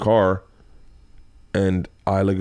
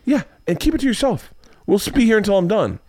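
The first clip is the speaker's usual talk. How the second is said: high-pitched mock irritation; exasperated retort; 11 semitones higher than usual. Dad answering daughter's homework excuse, exasperated. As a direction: shut the argument down, imitating a fed-up dad.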